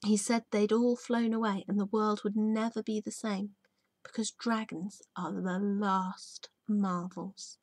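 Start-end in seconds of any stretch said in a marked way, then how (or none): none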